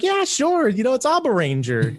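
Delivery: a hammed-up "sure, why not"; mock eager acceptance. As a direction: sell yourself on a bad bargain, half laughing. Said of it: "you're like".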